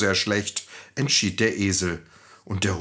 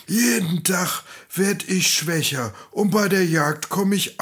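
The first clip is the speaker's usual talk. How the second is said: higher, plaintive dog voice; whining old dog; 7 semitones higher than usual. Acted. Old dog tells the donkey his troubles, whining. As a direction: pour out the woes of a tired old dog. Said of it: "jammerte der Hund atemlos".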